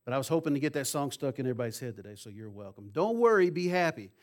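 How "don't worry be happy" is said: loud, high, punchy delivery; emphatic, spoken. Shown as a demonstration. Preacher driving the message home to the congregation, loud and emphatic. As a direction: proclaim it spoken, with no tune.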